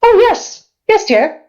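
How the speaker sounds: high, indulgent character voice; doting ancient being. Acted. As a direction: answer sweetly, pitched high, as to a child. Ancient being answers the visitor.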